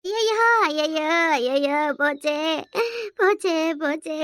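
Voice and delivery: drawn-out wail of despair; crying. Acted. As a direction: wail each word out long, falling into sobs. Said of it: "ரெண்டு பேரும் மாறி மாறி அழுறீங்க". (கதறி ஓலமிடும் குரல்)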